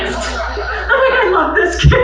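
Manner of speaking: high, loud, slow words through laughter; laughing delight. Real, not acted. Teacher laughing about a student who defended her name. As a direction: gush it out while laughing hard.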